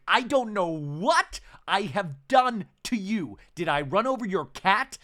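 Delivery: hammed-up indignant castmate; mock outrage. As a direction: play it hurt and indignant. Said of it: "Heather going".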